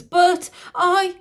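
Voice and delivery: high, loud cut-off protest; sputter, flustered; high flustered outburst; spluttering queen. A narrator's reading. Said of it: "spluttering her innocence".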